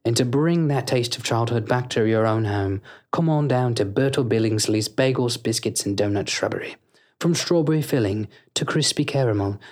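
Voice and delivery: smooth commercial announcer voice; hammed-up radio ad read; fake ad read. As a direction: sell the bakery like a cheesy radio spot.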